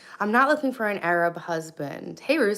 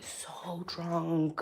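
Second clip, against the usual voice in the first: hushed confession, unvoiced; whispering; 3 semitones lower, 8 dB quieter than usual. Real, not acted. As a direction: whisper it like a secret. Confessing being drunk last night.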